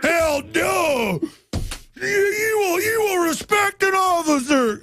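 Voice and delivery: high shouting angry officer; mock furious cop. Host playing cop scolding caller, high shout. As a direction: roar it like an outraged cop.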